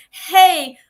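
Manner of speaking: high, loud hey; upbeat opening hey; lively greeting. Shown as a demonstration. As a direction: open with a bright call to the room. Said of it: "as you're talking, you should say".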